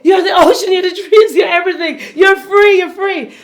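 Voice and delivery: high, loud, rousing inner voice. Retold or imitated. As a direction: cheer yourself on emphatically. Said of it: "this other one inside of me".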